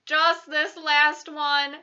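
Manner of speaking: pleading. A narrator's reading. Sophie asks to read one last dream, pleading.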